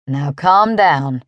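low-pitched, unhurried cowboy drawl; easy cowboy drawl. A narrator's reading. Cowboy calming a shaken driver, easy drawl. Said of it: "he said in an easy drawl".

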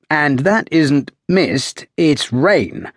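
ordinary delivery, nothing marked

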